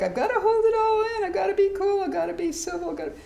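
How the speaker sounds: a mock nervous inner voice; mock uptight self. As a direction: parody the bottled-up self. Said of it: "We're so much like".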